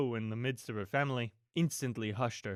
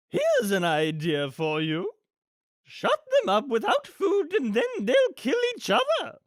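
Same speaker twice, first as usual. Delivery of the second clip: hatch a cruel plan with relish; theatrical villainess voice, loud and swooping; fiendish villainess; 13 semitones higher, 6 dB louder than usual. Acted. Villainess has a fiendish idea.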